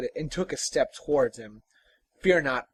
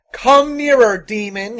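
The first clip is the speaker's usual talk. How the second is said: loud, raised challenge; defiant cry; 10 semitones higher, 14 dB louder than usual. Acted. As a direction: dare the demon to come on. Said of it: "he cried".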